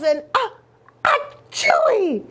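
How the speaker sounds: high wind-up sneeze ah ah chewy; comic sneeze. Acted. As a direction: build up and burst into a sneeze. Kitty sneezes.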